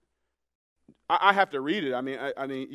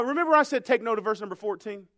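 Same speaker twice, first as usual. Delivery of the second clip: ordinary talk, nothing marked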